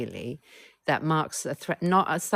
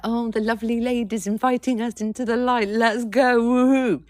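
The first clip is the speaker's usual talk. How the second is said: high, loud, gushing sarcastic glee; hammed-up childish cheer; mock eager kids; 9 semitones higher, 8 dB louder than usual. Inner children mocking the invitation: high, loud, sarcastic glee.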